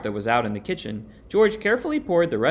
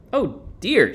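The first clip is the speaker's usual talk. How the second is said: startled "oh dear", higher; frightened cry; 7 semitones higher, 4 dB louder than usual. A narrator's reading. Martha scared by her face in the mirror, frightened cry. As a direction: cry out at the scary picture. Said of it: "she cried".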